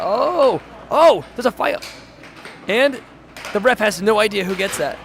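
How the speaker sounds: sudden alarmed play-by-play; excited call. Real, not acted. Commentator spotting a fight at the table, excited.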